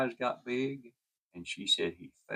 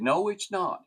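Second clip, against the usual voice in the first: retold insistence; 10 semitones higher, 6 dB louder than usual. Dying man insists he sees them.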